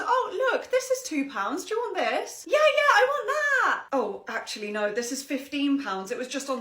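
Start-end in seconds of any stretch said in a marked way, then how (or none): 2.5-3.8 put-on high, loud eager girl; excited child. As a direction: grab at it like an excited kid. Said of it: "ten-year-old".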